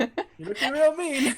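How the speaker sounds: put-on high, hesitant German accent. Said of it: "The German sound individual".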